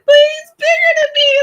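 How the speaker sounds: very high, loud mock wail; freaking-out child crying. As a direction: whine and bawl like a scared little kid. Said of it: "So I'm freaking out like".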